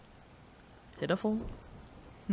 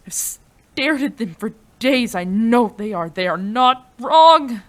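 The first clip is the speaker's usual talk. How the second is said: higher and louder, building to a frantic yell; desperate shouting; 4 semitones higher, 12 dB louder than usual. Acted. Scientist yelling as her revival experiment seems to fail, desperate shouting. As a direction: shout at the machine, refusing to accept failure. Experiment failing, she cries out.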